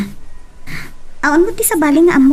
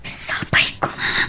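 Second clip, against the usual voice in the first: whisper; 7 dB quieter than usual. Acted. Whispered aside in her monologue.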